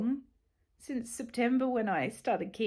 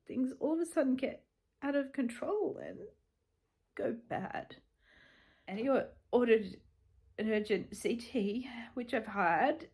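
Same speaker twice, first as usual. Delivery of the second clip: usual speaking voice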